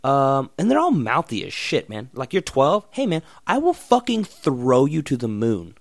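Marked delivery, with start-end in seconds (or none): 2.9-5.8 slow, higher mock-tough threat; hammed-up tough guy. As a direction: playfully menace the kid. Threatening mouthy kids.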